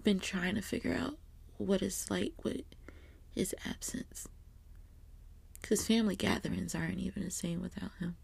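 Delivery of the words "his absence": tearful whisper. Real, not acted.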